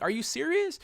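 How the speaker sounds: loud, very high disbelief; incredulous. Retold or imitated. Patient challenging the negative specialist, loudly incredulous. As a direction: voice leaps up in outrage.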